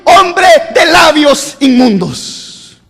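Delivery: high, slow, drawn-out cry; trembling temple, prophet laments slowly; prophet's lament. Acted. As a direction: wail it out like a broken man. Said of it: "él dice".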